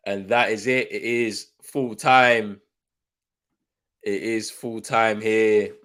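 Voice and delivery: slow, drawn-out full-time call; commentator call. Acted. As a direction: announce the final whistle like a match commentator. Calls the final whistle.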